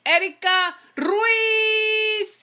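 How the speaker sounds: Ruiz with the R trilled, slow and high; rolled r. Acted. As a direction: roll the R in Ruiz, drawn out. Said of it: "you like how I rolled my R's there".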